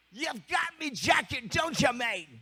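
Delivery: high, slow English accusation. Retold or imitated. [name] grabbing the thief's hand, high slow English voice. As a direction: loom over him, drawing it out.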